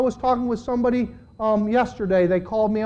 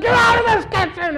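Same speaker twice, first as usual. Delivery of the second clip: high, loud mock yell; shouting wife; 13 semitones higher, 6 dB louder than usual. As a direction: bark it like an angry wife with a frying pan.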